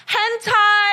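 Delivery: mock shout back, high and bright. Shouting back at a heckler in Margate, mock yell. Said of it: "I just shouted back".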